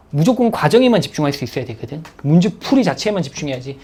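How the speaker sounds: usual speaking voice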